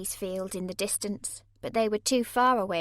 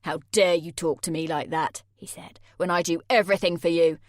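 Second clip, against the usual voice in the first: angry; 3 semitones lower, 6 dB louder than usual. A narrator's reading. Jay enraged.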